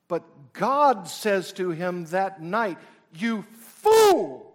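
huge shout on "You fool!"; thundering rebuke. Acted. Preacher voices God roaring at the rich fool. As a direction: roar "You fool!" as God's judgment. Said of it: "Excuse the power of my voice".

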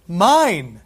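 Mine repeated loud and falling; echoed mine. Acted. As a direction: say it back with joy. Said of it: "present tense".

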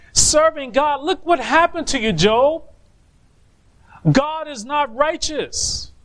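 high, pressing, exasperated wife's voice; Job's wife. Acted. Wife urging Job to curse God, high exasperated pleading. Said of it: "And she's saying".

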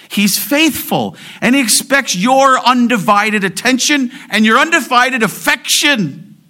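high, loud sermon delivery; forceful pulpit proclamation; impassioned preaching. Real, not acted.

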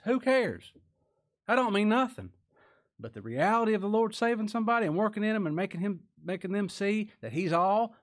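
high, loud, fast preaching; preaching at full force. Real, not acted. Preacher to congregation, high loud fervent preaching. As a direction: drive it home with heat.

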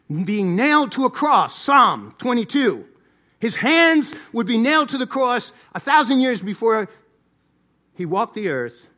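loud, punched pulpit proclamation; forceful preaching. Real, not acted. Preacher citing prophecy of the cross, loud forceful proclamation. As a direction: hammer the key words out loud.

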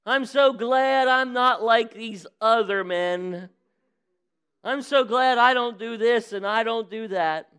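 high, loud, slow self-congratulating prayer; boastful Pharisee. Retold or imitated. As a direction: brag to God at full volume. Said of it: "so loud".

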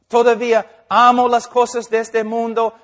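confesión imitada. Retold or imitated. Pecador confiesa amar el mundo.

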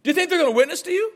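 high, fast exasperated comeback; quick incredulous voice; mock incredulous retort. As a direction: scoff at the offended churchgoer. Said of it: "I'm like".

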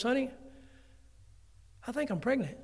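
a hint of a nervous wife; mock-tentative wife. Preacher imitating his wife breaking the news, lightly voiced. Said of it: "She goes".

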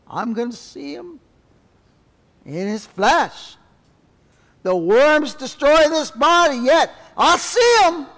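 rising from plain to high, loud and slow; building preaching. Real, not acted. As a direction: build up to a triumphant cry.

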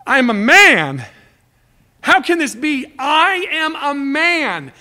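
high, loud, and drawn-out on the last line; the old priest protests that he is only a man; incredulous protest. Acted. Preacher voicing Zacharias, who protests loudly and incredulously.

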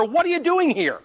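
high-pitched, incredulous mock outburst; exasperated question. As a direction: demand it in acted exasperation. God exasperated with drowned man.

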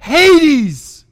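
loud, high pleading cry; Jesus pleading with sinners, loud and high. Acted. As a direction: plead with them like they're drowning. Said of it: "can you hear him pleading with them".

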